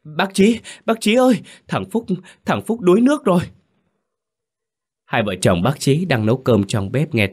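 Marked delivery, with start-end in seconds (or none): 0.0-3.4 high, loud panicked yell; boy bursts in shouting that his friend drowned. A narrator's reading. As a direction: call out for the neighbour at full voice. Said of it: "tôi đã hét lớn".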